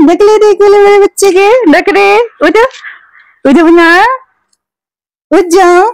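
high, loud sing-song wake-up voice; coaxing wake-up call. Real, not acted. Mother waking sleeping baby.

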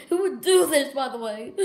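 loud, high half laugh half sob; laughing cry. Acted. Laughing through pain.

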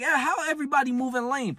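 high, scornful whine; high mock disgust. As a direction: sneer at everyone's lameness. Disgusted retold complaint.

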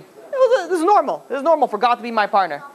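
high-pitched, matter-of-fact innocence; completely innocent. Acted. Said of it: "This guy, completely innocent".

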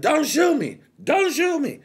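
high, loud desperate cry; panicked yelling. Retold or imitated. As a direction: scream it for your life. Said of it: "why the fuck is he yelling this out".

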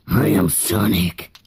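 deep slowed voice changer. Pretend. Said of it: "A voice changer? Let me use this".

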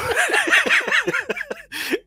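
high wheezy laugh; real helpless laughing, pitched high; burst of laughter. Real, not acted. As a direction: crack up mid-announcement. Cracks up starting the countdown.

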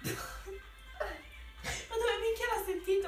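slow laughing disbelief. Real, not acted. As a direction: laugh through the words.

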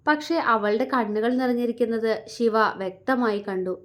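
usual speaking voice

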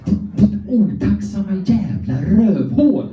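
high, hammed-up outraged scolding, laughing through it; furious rant at his own body. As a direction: chew out his ungrateful butt like a betrayed parent. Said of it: "var röt och bara".

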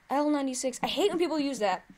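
normal talking voice

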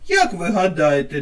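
usual speaking voice

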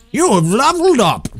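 high announcer call of a level up; like a video game announcer. Pretend.

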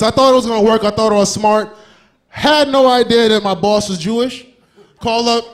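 ordinary delivery

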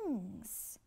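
usual speaking voice

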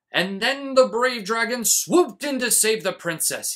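loud, high storybook boom; booming read-aloud. A narrator's reading. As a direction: boom it out to lure the dragon. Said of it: "read aloud in a booming voice".

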